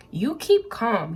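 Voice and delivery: slow, with weight on keep; slow and emphatic. Real, not acted. Reader repeating to viewers they keep calm, slow and emphatic. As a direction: lean on keep and take it slowly.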